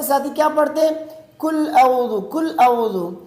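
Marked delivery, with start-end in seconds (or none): 1.4-3.1 "کل اعوذ" with kaf for qaf, hamza for ayn; common mispronunciation. Shown as a demonstration. Teacher imitates friends' common mistake, mispronounced qaf and ayn. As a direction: say "کل اعوذ" quickly with a light front k and no throat sound. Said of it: "قاف کو کاف پڑھتے ہیں".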